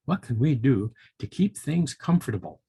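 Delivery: plain reading voice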